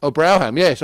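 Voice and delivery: loud surprised "Oh, Brougham" with "Brougham" said the local way; suddenly getting it, "Oh, Brougham"; surprised realisation. Real, not acted. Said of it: "is that what it's called".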